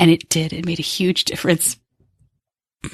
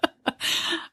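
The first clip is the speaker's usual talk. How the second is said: breathy laugh; 9 semitones higher, 9 dB quieter than usual. Real, not acted. Laughs at own emotion.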